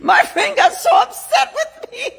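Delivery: cracking up loudly while telling it; laughing shout. Real, not acted. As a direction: shout it through a big laugh. Bursts out laughing, loud.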